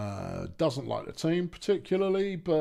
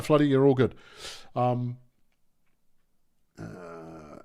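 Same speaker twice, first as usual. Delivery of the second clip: ordinary delivery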